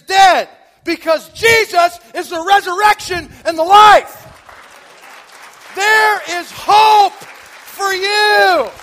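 loud, high, slow altar-call preaching; fiery preaching. Acted. Preacher urging his congregation toward hope, booming from the pulpit. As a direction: preach it hard, warning and pleading.

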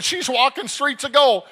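high, loud triumphant cry; shouted proclamation. Retold or imitated. Father telling nurse about his dead daughter, shouted proclamation. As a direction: cry it out at full force.

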